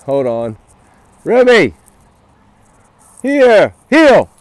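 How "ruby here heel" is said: loud one-word commands; sharp, urgent recall; calling the dog. Real, not acted. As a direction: shout firmly for a loose dog.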